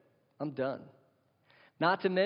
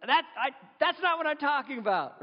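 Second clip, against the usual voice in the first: much higher, whiny protesting husband; defensive husband; 11 semitones higher than usual. Retold or imitated. Husband protesting to his mad wife, much higher whiny voice. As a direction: protest your innocence in a high, put-upon voice. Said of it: "He's like".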